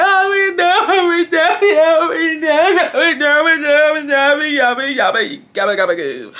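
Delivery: high drawn-out mock wail, sinking; hammed-up bawling that slurs and falls in pitch; imitated crying. Teen dragged downstairs by mom, wailing in mock tears. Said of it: "I'm crying".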